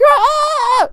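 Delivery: high, drawn-out imitation scream; mock scream. Imitating a character who can't talk normally, high mock scream. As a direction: screech it like an overacted anime hero. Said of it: "The dude's just like".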